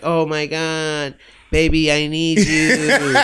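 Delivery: put-on slow, pleading "baby" voice on the phone; needy girlfriend voice. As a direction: draw it out like a clingy caller. Imitating girls calling for money.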